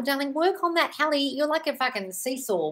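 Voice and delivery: ordinary delivery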